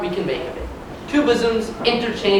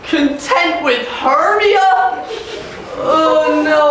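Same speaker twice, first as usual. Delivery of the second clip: scoffing; 6 semitones higher, much slower than usual. Acted. Lysander scoffs at the idea.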